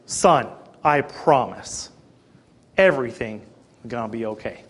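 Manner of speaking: repeating comfort to a worried son; calm reassurance. Retold or imitated. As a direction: promise it gently, trailing off. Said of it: "I made a special point to say again".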